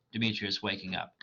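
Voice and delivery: ordinary delivery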